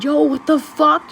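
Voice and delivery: high, loud shocked yell. Retold or imitated. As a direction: blurt it out in shock. Shocked after being choked.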